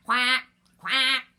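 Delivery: two loud imitated quacks; duck quack. A narrator's reading. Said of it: "called the duck".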